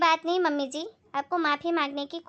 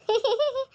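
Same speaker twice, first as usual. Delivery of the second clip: quick amused giggle; a stifled laugh she can't hold back; short laugh; 7 semitones higher than usual. Acted. Bahu laughing at mother-in-law's double standards, amused giggle. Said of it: "मुझे तो बस ये सोचकर हंसी आ रही थी".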